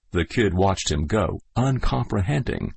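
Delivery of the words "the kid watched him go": plain reading voice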